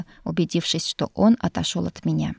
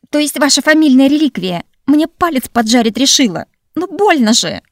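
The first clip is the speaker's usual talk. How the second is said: high loud cries of pain; pained shouting; 7 semitones higher, 10 dB louder than usual. Acted. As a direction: yell it as your finger burns. Finger burned by the ring. (кричит от боли)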